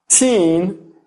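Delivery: put-on "teen" with spread lips, long E. Shown as a demonstration. Teacher demonstrates "teen" to the students, long E. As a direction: stretch "teen" into a smiling long E. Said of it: "compare these sounds with words like".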